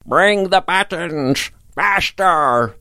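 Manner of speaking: high, slow parrot squawk of a voice; shouting parrot. A narrator's reading. Parrot demands buttons while looking at the children, high mock shout. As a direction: screech it slowly like a demanding old parrot. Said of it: "the parrot shouted again".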